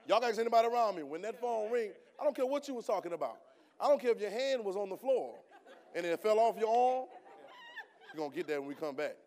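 loud, high shouted preaching; fiery preaching. Acted. Preacher tells the church how his father's call stops everything, preaching at a loud, high pitch. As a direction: preach it at full force to the room.

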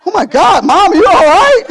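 high, loud worried cry; hammed-up scared yelp; mock scared son. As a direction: call out in alarm to mom. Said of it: "Jason's getting scared".

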